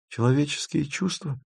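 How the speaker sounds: high, soft insistence; persisting. A narrator's reading. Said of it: "упорствовала Маша".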